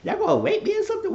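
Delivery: very high, rushed, scared shriek; squealing in fear at a room full of goons; panicked squeal. Retold or imitated. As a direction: squeak it out as if you're cornered. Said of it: "I was like".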